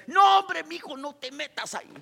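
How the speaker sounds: a loving father calling out to his stubborn son; God scolding. Retold or imitated. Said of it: "yo me imagino que Dios está ahí".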